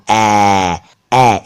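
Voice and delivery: "ए" stretched as wide "aeee"; drawn-out open AI. Shown as a demonstration. Teacher saying which sound is meant, long open "ए". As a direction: sustain "ए" slowly with the jaw dropped, "ae". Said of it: "अपने मुंह को ज्यादा खोल रहा हूं".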